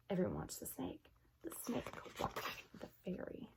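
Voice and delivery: hushed suspense whisper; tense whisper. A narrator's reading. Narrator whispering as the snake stares. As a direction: whisper it so nobody breaks the spell. Tense moment, orchard frozen.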